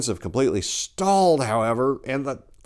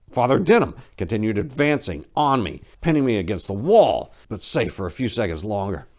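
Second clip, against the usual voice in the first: plain reading voice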